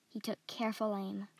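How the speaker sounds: ordinary delivery, nothing marked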